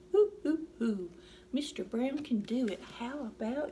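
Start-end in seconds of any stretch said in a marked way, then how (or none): 0.1-1.1 owl hoot. Pretend. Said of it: "He can sound like an owl".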